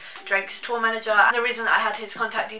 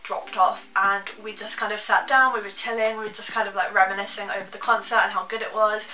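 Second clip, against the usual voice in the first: usual speaking voice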